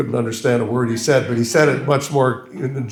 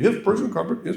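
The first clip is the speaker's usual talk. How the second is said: put-on high, swallowed English accent; Berlin imitation; 8 semitones higher, faster than usual. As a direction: mimic a mumbling Oxford don. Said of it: "I'll try to".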